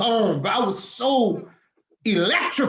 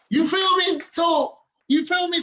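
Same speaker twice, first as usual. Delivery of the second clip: higher, louder, pressing for agreement; fired-up appeal; 9 semitones higher, 3 dB louder than usual. Real, not acted. Asking the interviewer if he feels him, a high fired-up appeal. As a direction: push it out at the top of the voice.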